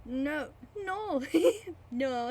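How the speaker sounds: chuckling high no's. Acted. Landlord chuckles at the narrator's question, laughing. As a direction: laugh the denial out. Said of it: "chuckled again with his learned chuckle".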